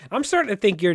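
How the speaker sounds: ordinary talk, nothing marked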